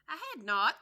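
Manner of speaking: loud, high angry snap; sharp angry retort. Acted. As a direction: bite back furiously. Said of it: "mouse sharply and very angrily".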